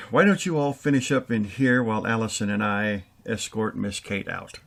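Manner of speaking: ordinary talk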